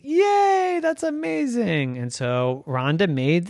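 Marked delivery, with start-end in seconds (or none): 0.0-2.0 high, gleeful cheer of delight; excited yay. Acted. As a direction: squeal it like a thrilled puppy. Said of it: "Puppy said".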